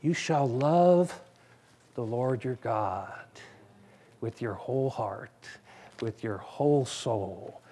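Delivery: low, soft scripture reading; hushed and reverent verse; solemn quoted verse. Acted. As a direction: read it quietly as the Lord's own words. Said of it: "this is him speaking to you personally".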